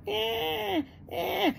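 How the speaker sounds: high mock baby whimpers; squeaky imitation of a fussing infant.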